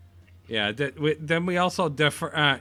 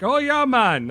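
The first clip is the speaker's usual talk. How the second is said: higher, louder hammed-up Jamaican accent; mock Jamaican accent; 10 semitones higher, 7 dB louder, slower than usual. To his podcast cohost, explaining his failed New York accent, in a mock Jamaican accent. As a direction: slip into a jokey Jamaican lilt. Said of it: "I'm either Jamaican".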